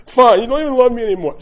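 loud, high-pitched mock huff; sulking kid. Kids reacting to being corrected, mock wounded. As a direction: imitate a child's manipulative outburst. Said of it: "that's called manipulation".

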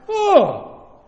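high startled cry; shocked gasp. Real, not acted. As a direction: recoil in outrage. Shocked reaction to news.

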